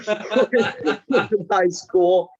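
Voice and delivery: words laughed out slowly; laughing. Real, not acted. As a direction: giggle through the line. Laughing through mockery.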